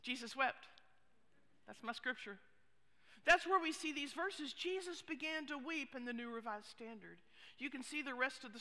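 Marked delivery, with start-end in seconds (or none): 0.0-2.4 lightly voiced kid, quick recital; little kid reciting. Kid asked to memorize Scripture answers, lightly imitated quick recital. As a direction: offer it briskly as a kid's easy answer, only lightly imitated. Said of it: "they say, especially a little kid".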